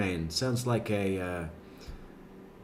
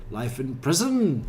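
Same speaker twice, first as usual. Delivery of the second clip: PRISON swung up at the end; weird upward inflection; 2 semitones higher than usual. Retold or imitated. Mimicking Chris Watts's answer, "prison" inflected weirdly upward. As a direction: lift "prison" oddly upward like a question. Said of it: "He does this weird up inflection thing at the end".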